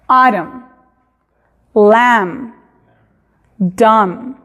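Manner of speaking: "autumn, lamb, dumb" ending on the m; English demo; silent final n and b. Shown as a demonstration. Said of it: "No se pronuncia ni la B ni la N".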